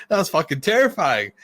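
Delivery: high, emphatic retold protest; retold indignant outburst. Insisting to laughing coworkers after a scare, retold indignation. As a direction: insist on it, re-enacting the outrage.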